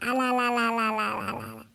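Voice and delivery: low lamenting ala ala chant fading off; wailing ala ala. Acted. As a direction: wail ala ala like a grieving old woman. Old woman wailing syllables. (ಕೆಳಧ್ವನಿಯಲ್ಲಿ ಅಲ ಅಲ ಎಂದು ಗೋಳಾಡುವುದು)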